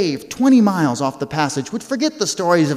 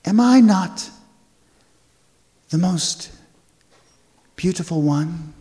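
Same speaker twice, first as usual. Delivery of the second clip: Ice Queen voice. Acted. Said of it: "she would say".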